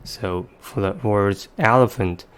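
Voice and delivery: ordinary talk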